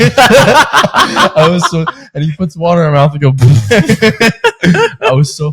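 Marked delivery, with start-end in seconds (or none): 0.0-1.8 high, slow laughing words. Real, not acted. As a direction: laugh through it. Laughing while telling story.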